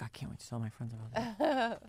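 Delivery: soft aside to oneself; eager inner thought. Retold or imitated. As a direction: think it aloud, quietly. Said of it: "you start to save things up. Like".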